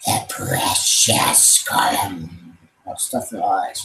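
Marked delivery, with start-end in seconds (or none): 0.1-2.3 slow, rasped Gollum voice; Gollum impression. Streamer shows the kind of voice people do. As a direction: drag and rasp it like Gollum. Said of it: "people can do Gollum".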